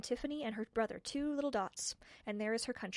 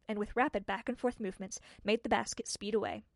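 plain reading voice

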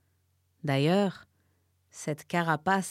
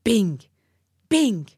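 loud, punchy bing bing; bing sound effect; 5 semitones higher, 9 dB louder than usual. A narrator's reading. Narrator voices the slaps, loud bings. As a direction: smack out each bing.

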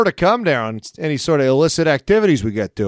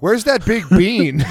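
tourist asking; 6 semitones higher, slower than usual. Pretend. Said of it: "my bit... be like".

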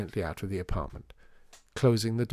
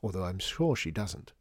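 plain reading voice

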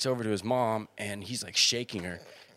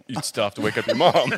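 laughing; 7 dB louder than usual. Retold or imitated.

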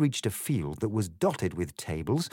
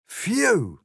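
high, loud relieved phew; relieved sigh after a rough landing; cat's relieved phew; 11 semitones higher, 5 dB louder than usual. A narrator's reading. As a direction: blow out a big phew of relief. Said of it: "said the cat".

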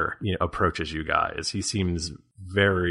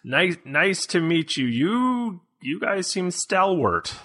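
hammy stage actor; 10 semitones higher than usual. Acted. GM voices village elder Ballard.

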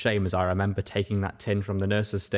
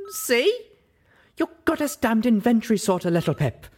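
high, loud bark; angry mare barking at her friend; 14 semitones higher, 4 dB louder than usual. Acted. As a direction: snap the words at her. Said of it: "Velvet Remedy barked".

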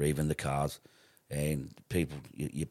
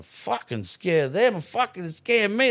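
high, loud, slow outraged yell; furious retold shout at the wife; 13 semitones higher, 8 dB louder than usual. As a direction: yell it in disbelief, pitch way up. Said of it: "I'm going".

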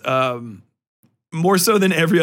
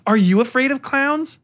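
pitched-up mock interviewer question; 10 semitones higher than usual. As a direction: ask it like a cheesy host, higher. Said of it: "They're just like".

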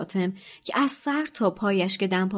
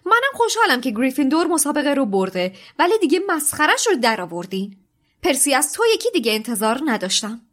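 loud, fast, high scolding; angry; 6 semitones higher, 5 dB louder, faster than usual. A narrator's reading. McGonagall scolding the house, fast angry. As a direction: rattle off a stern telling-off. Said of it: "با عصبانیت".

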